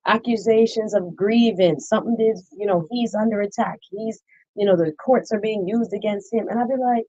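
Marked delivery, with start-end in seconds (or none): none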